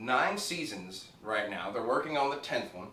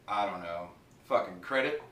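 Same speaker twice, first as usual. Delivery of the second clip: usual speaking voice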